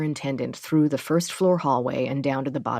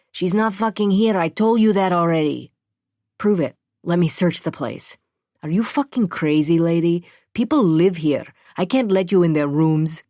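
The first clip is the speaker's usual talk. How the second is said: raised, louder angry voice; angry refusal; 3 semitones higher, 6 dB louder than usual. Acted. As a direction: snap back at her in anger. Angry super refusing search.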